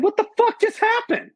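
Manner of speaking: very high, strained mock yell; retold panicked yell. Retold scream after waking.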